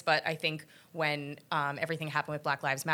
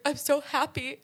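retold happy crying; 10 semitones higher than usual. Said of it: "crying, like, sobbing".

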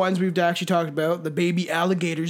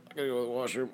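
quiet quoted mumble; 7 semitones lower, 10 dB quieter, much faster than usual. Retold or imitated. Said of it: "I was like".

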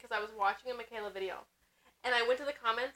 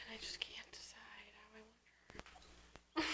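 breathy whisper to herself; hushed, half to herself; 4 semitones lower, 23 dB quieter, slower than usual. Real, not acted. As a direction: whisper it like a private thought. Musing to herself after a sigh.

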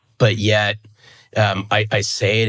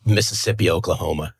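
normal talking voice

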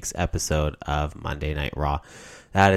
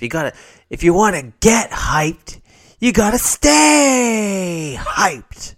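high, slow hype-man voice; hyped-up; 13 semitones higher, 6 dB louder, slower than usual. Acted. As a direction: pump it up like a motivator. Mock hype motivational line.

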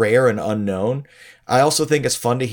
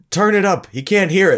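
loud pushy New York manager; manager yell; 8 semitones higher, 4 dB louder, faster than usual. Retold or imitated. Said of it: "Blaze keeps going".

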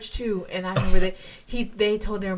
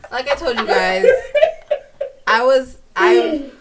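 louder, laughing through the words; 6 semitones higher, 8 dB louder than usual. Real, not acted. Admitting to friends she was a mean girl, laughing. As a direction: giggle out an embarrassing admission.

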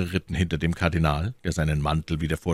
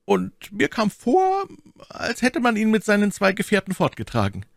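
lightly voiced gentle musketeer, pitched up; mild-mannered Aramis; 10 semitones higher than usual. A narrator's reading. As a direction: justify yourself with mild innocence. Voices Aramis justifying himself.

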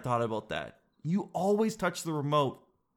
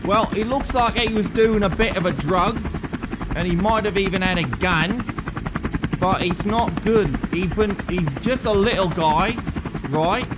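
British reporter voice; 5 semitones higher, 5 dB louder than usual. Acted. Nigel reports from helicopter.

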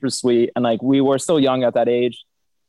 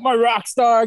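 imitating the principal; 9 semitones higher, slower than usual. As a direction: gush like a proud principal.

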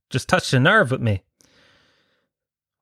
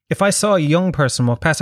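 ordinary talk

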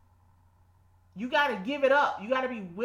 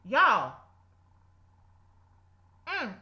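normal talking voice

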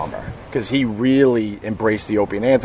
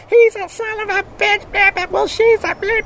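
both exes shrieking at the cops; cartoonish high mock argument; squealing feuding ex. Neighbors yelling at cops.

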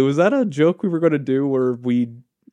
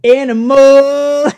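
drawn-out, high, loud wail of one word; unhinged villain; 12 semitones higher, 10 dB louder, much slower than usual. Acted. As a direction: howl the word like a crazed rock singer. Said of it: "the lead singer just goes".

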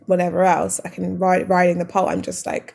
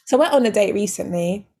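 normal talking voice

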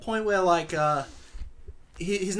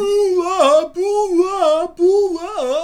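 much higher, louder cartoonish voice; impersonating the narrator; 13 dB louder, much slower than usual. Asked by host, friend mimics the movie voice, high and loud. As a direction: squeeze it up high and loud as a silly impression. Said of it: "could you do an impersonation of how he sounds?".

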